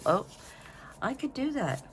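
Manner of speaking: lightly voiced thought, pitched high; quoted passing idea. Crafter telling viewers how she gets sidetracked, lightly acted high-pitched thought. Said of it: "You know, I'll go".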